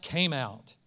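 normal talking voice

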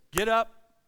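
sudden loud, high order; commanding God. Retold or imitated. God ordering Jonah to go, commanding. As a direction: call it out as a firm command.

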